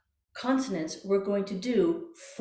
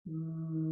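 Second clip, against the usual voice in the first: a soft mm hummed into a straw; quiet mm through a straw; 4 semitones lower, 6 dB quieter than usual. Shown as a demonstration. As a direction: hum gently through the straw. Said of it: "Voicing, mm, through a straw".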